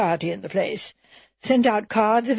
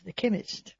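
plain reading voice

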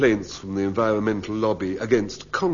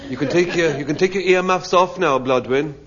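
raised voice over earmuffs; calling out loudly; 6 semitones higher, 6 dB louder than usual. Pretend. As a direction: call it out so she hears. Shouting to earmuffed Blodwyn.

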